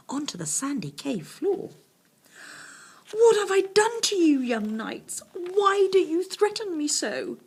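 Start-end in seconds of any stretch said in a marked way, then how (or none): 3.1-7.4 high, loud, quavering dragon voice; a weepy dragon pleading, voice trembling up high. A narrator's reading. Frightened dragon asks the boys, high and quavering. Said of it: "he said in a quavering voice".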